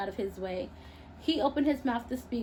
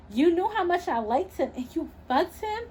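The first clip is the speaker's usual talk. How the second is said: higher, rising angry accusation; raising her voice; 8 semitones higher than usual. Acted. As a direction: confront her best friend. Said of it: "raising her voice".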